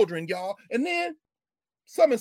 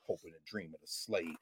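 very low, soft delivery; hushed aside; 14 semitones lower, 14 dB quieter than usual. Shown as a demonstration. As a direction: drop right down, almost under the breath. Contrasts human being with slave.

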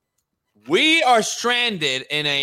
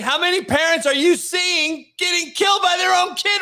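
heated shout; 10 semitones higher than usual. Real, not acted. Heated argument over parenting.

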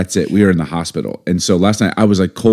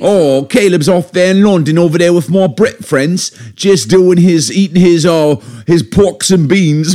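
high, hammed-up British accent, slow at the end; a playful fake London voice; mock London accent; 9 semitones higher, 4 dB louder, slower than usual. Husband asked by his wife for London, mock British accent. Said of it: "Can you do your best London accent?".

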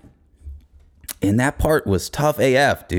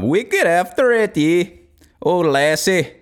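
high, hammed-up Irish brogue; mock Irish drinker; Irish accent; 7 semitones higher than usual. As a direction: play up the Irish heritage in a put-on lilt. Irish put-on voice returns.

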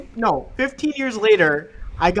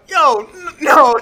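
loud, high, drawn-out "Yo, no"; retold startled turn-down, pitched up; mock alarmed refusal; 8 semitones higher, 8 dB louder than usual. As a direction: balk loudly, stretching and stammering the no. Retold refusal of shady deal.

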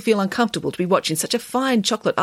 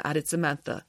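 plain reading voice

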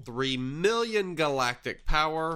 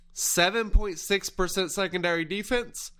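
talking through a yawn; stretched mid-yawn words; 7 semitones higher than usual. Real, not acted. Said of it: "excuse my yawn break".

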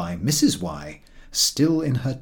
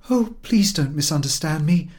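pleading; 7 semitones higher, 4 dB louder than usual. A narrator's reading. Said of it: "pleaded Missus X".

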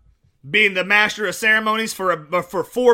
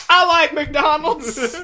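high, slow, stretched-out whine; mock-hurt outburst at being left out; hammed-up protest; 7 semitones higher, 4 dB louder, much slower than usual. Walker replying to friends who drove past, mock protest.

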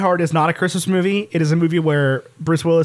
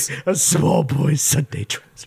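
gruff Batman voice; 2 semitones lower than usual. Acted.

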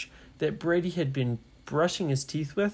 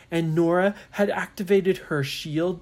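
plain reading voice

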